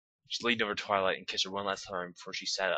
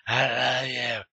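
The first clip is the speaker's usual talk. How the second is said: higher, louder, slow tender character voice; lightly voiced Twilight; Twilight's voice; 5 semitones higher, 7 dB louder, much slower than usual. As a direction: say it like a lover, slow and high. Twilight voiced in love scene.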